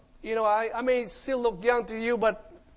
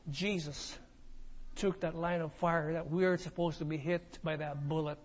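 reverent; 6 semitones lower, 11 dB quieter than usual. Real, not acted. Sermon turn, low and soft.

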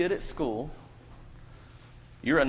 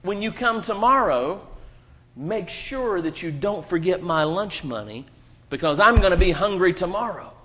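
bully threat; 4 semitones higher, 6 dB louder than usual. Retold or imitated. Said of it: "And they told him".

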